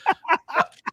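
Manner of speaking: high cackle; witch cackle. Acted. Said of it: "Wicked Witch voice again".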